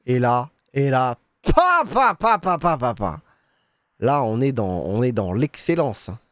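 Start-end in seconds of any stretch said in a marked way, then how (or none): 1.4-2.8 playful sound effects. Acted. Sound effects while pouring the latte art.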